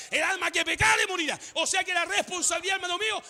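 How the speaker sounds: high preacher's delivery, last word stretched; emphatic preaching. Real, not acted. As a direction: hammer it home, stretching the final word. Preaches at full force.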